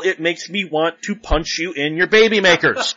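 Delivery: normal talking voice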